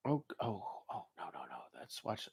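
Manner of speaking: barely audible oh no no; hushed dismayed mumble; under his breath. Real, not acted. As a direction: drop to a near whisper of dismay.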